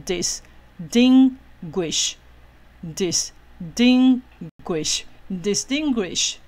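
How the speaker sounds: distinguish 拆成三节，慢慢念; 一节一停地念 distinguish，最后连起来; 分音节示范. Shown as a demonstration. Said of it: "只要一个音节是一个母音就可以了". (慢速分音节拼读 distinguish)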